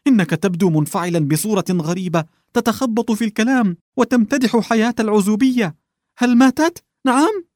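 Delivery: begging for the truth, panic climbing; frightened husband demanding an answer; anxious pleading. Acted.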